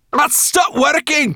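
high, drawn-out cry; alarmed cry. A narrator's reading. Sorrel as the tiring dragon spins, alarmed high cry. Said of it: "cried Sorrel".

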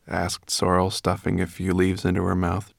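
ordinary delivery, nothing marked

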